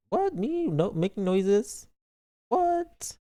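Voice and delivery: high squeaky disbelief; high-pitched denial; mock indignant. Real, not acted. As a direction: play scandalized, voice jumping up. Denying moaning accusation.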